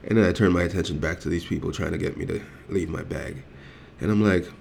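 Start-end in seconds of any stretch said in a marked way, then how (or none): none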